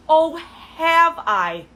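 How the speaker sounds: hammed-up disbelief, stretch every word; exaggerated sarcasm. Real, not acted. Repeats the mockery, bigger.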